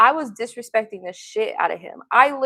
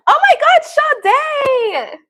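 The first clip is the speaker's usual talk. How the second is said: high, loud, drawn-out squeal of welcome; excited greeting; 13 semitones higher, 8 dB louder, much slower than usual. Real, not acted. Host welcoming Sade onto the show, thrilled high squeal. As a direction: throw the door open for a best friend.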